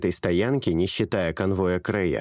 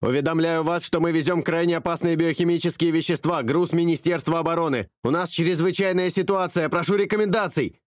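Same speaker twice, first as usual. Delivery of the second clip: higher pilot through static; distorted radio voice; 8 semitones higher than usual. A narrator's reading. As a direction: speak through crackling radio. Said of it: "искажённый помехами". (голос пилота, искажённый помехами)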